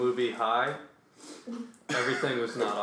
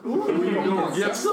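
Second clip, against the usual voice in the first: higher, laughing mock come-on; playful catcall; 6 semitones higher than usual. Hosts trying the episode title, giggly mock catcall. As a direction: tease it out as a hammed-up catcall.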